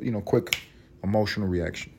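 normal talking voice

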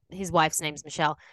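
ordinary talk

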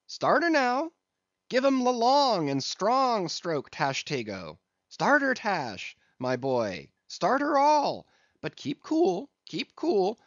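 high, rousing calls to the rowers; high-pitched coxswain's cry, dipping on the names. A narrator's reading. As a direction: urge the crew on in a high calling voice. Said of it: "cried Stubb".

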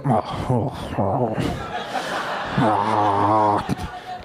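low, drawn-out disapproving oh's; imitating a prudish father's low groaning; pained dad groans. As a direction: groan like a dad wincing at TV swearing. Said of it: "He would just be like".

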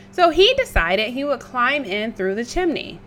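usual speaking voice

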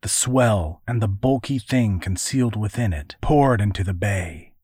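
ordinary delivery

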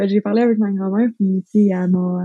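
normal talking voice

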